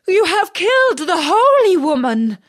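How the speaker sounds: high, loud horrified princess; shocked cry. Acted. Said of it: "cried the princess".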